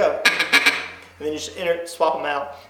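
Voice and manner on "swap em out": ordinary talk, nothing marked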